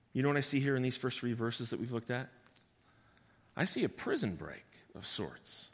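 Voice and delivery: ordinary delivery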